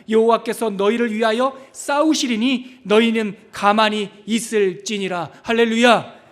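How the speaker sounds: ringing scripture declaration with stretched key words; declaimed scripture. Acted. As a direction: proclaim the verse at full force to the congregation. Declaims scripture at full force.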